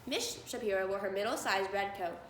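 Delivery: ordinary delivery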